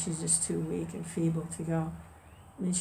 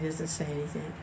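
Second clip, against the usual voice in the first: normal talking voice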